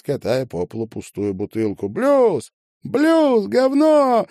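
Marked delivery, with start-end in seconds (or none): 1.9-4.3 put-on very high, loud girl's yell; shrieked call from the crowd; shouting. A narrator's reading. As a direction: scream out the demand, high and loud. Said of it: "крикнула высокая девушка".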